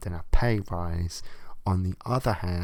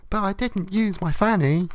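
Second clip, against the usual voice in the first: much higher, whiny mock woman voice; mock woman's excuse; 11 semitones higher than usual. Comic voicing the cheating woman's feeble excuse in a high mock voice. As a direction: squeak the lame excuse like a petulant girlfriend.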